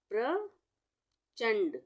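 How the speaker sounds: प्रचंड drawn out very slowly, the half र gliding straight into प as प्र; careful slow model word प्रचंड with the प्र cluster; half r pronunciation. Shown as a demonstration. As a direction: stretch every sound of प्रचंड like a teacher at the board. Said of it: "आधे र का उच्चारण होगा इससे".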